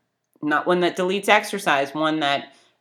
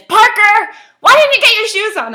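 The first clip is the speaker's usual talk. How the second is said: much higher and louder exasperated yell; raised voice at child; 13 dB louder than usual. Retold or imitated. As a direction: let it burst out, fed up with the waiting.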